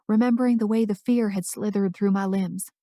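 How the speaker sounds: usual speaking voice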